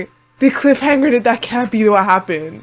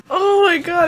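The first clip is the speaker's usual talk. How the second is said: tearful; 6 semitones higher than usual. Real, not acted. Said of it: "I started crying".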